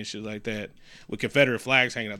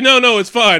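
breezy captain; 10 semitones higher, 12 dB louder than usual. Retold or imitated. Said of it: "And he's like".

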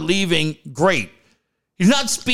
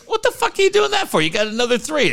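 mock outrage; 8 semitones higher, faster than usual. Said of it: "they were like".

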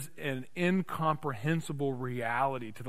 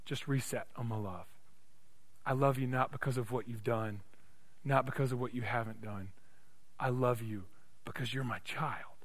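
tender reassurance; 5 dB quieter than usual. Acted. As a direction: hush down and assure the child of love. Voices the Father's love.

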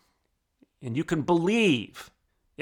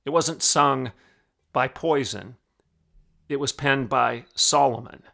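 normal talking voice

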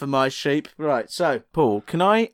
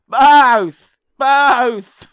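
loud high bleat of a word; shouted sheep voice; 8 semitones higher, 9 dB louder than usual. Acted. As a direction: bellow it like a blind sheep. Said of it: "and he'll go".

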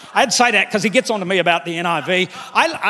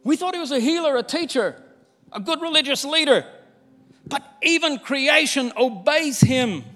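awed wonder; 7 semitones higher than usual. Acted. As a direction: marvel at him aloud. Disciples in awe.

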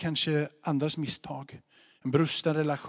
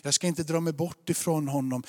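normal talking voice

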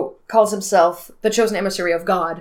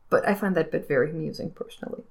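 normal talking voice

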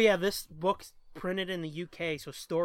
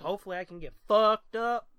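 normal talking voice

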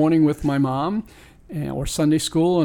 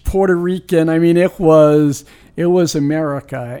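rising excitement; 2 semitones higher, 7 dB louder than usual. Real, not acted. Super Bowl street celebration memory.

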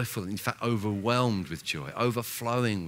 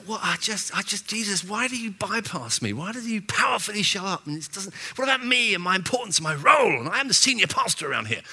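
high, mock-aggrieved whine at Jesus; mock self-pity; 9 semitones higher, 3 dB louder, faster than usual. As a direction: ham up a wounded pastor's ego, faster toward the end. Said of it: "I'm making a joke of it".

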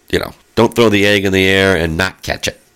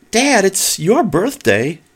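put-on kids' reply; 12 semitones higher, slower than usual. Dad voices his kids' reply.

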